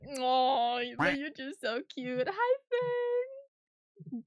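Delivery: high cooing, stretching the words; cooing baby talk. Acted. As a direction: gush over him like a cute baby. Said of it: "And he's like".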